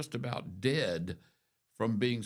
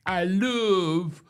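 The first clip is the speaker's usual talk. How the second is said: higher, louder, slow hammed-up British accent; mock British accent; 6 semitones higher, 5 dB louder, much slower than usual. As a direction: put on a posh-sounding British accent, stretching the words slowly. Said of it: "she talks with a British accent".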